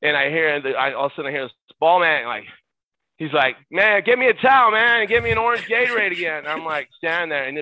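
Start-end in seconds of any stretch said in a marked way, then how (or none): 3.7-6.4 high, loud mock yell of a demand; retold yell. Player demanding a towel from the ball boy, retold yell. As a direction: bellow the order, imitating an impatient star. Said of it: "but he yelled it".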